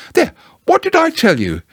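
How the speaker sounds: high, told-you-so mother voice; Kanga's voice. Acted. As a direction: gently chide him. Kanga scolding.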